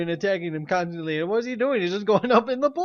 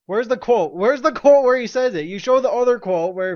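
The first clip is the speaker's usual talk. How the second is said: demanding shout; 5 semitones higher, 8 dB louder than usual. Real, not acted. Demanding proof, angry.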